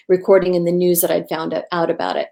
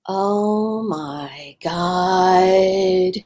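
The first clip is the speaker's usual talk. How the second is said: very slow, stunned; imitating a stunned stranger; shaken; much slower than usual. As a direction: let it drop out slowly in shock. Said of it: "she was shaken".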